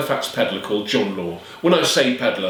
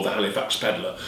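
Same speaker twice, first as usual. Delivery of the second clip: ordinary delivery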